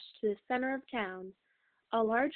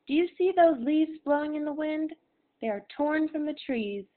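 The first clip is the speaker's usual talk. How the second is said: raised, earnest voice of a mother warning her son; mother's warning; 5 semitones higher, 6 dB louder than usual. A narrator's reading. Mother warns Henry that slave families get torn apart, earnest and raised. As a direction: lift it higher and louder, pleading with him to understand.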